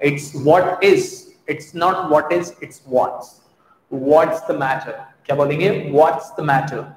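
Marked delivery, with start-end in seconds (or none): none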